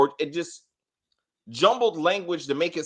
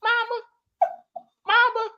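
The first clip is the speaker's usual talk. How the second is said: put-on very high, squeaky little-kid call; mock a grown woman calling out like a small child; exaggerated baby voice; [name]. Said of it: "Why are you talking like this?".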